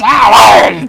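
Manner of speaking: sudden loud yelp of pain; mock pained yell. Host acts the bitten man's yelp, loud mock pain.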